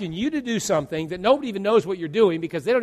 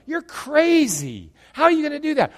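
mock incredulous; 8 semitones higher than usual. Critics mocking the pastor.

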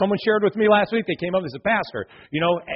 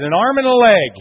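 punchline; 5 semitones higher, 9 dB louder than usual. Acted. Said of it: "And he said".

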